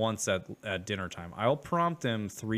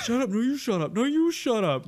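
put-on much higher, louder childish squabble; mocking bickering; 13 semitones higher, 5 dB louder than usual. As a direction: squeal it back like a squabbling kid. Friend mocks the bickering.

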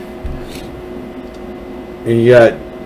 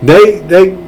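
usual speaking voice

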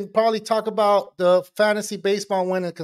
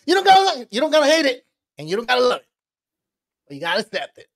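imitating Tony; 5 semitones higher, much faster than usual. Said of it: "But I think Tony would say".

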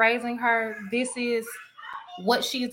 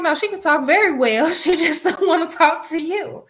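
laughing retort; 8 semitones higher, 6 dB louder than usual. Retold or imitated. Retelling her answer, laughing.

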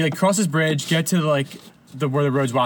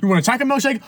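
high, teasing list of options; mock-impatient order taker, high-pitched; 10 semitones higher, 3 dB louder than usual. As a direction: rattle off the choices like an exasperated drive-thru worker. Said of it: "he was, like, making fun of her".

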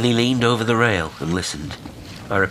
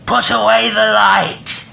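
high, slow, drawn-out yell; distant shout; 9 dB louder, slower than usual. A narrator's reading. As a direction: shout a command from far across the water. Reply shouted from distant boat.

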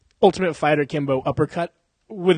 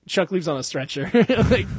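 normal talking voice

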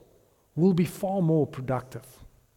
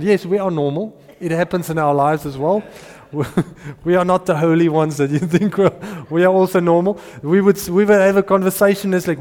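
ordinary talk, nothing marked

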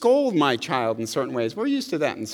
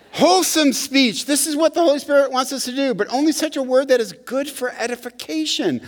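higher, louder preaching voice; raised sermon delivery; full preaching; 8 semitones higher, 3 dB louder than usual. Acted. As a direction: preach it out with force.

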